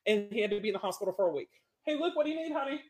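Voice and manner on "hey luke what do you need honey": calling to son. Real, not acted. Mom calls to her son.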